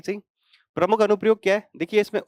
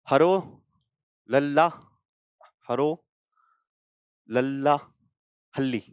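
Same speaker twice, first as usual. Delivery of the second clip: halting, piece-by-piece place name; broken into chunks; slower than usual. Shown as a demonstration. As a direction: sound out the long name piece by piece, pausing between chunks. Sounding out a long village name. (टुकड़ों में रुक-रुककर बोला गया लंबा नाम)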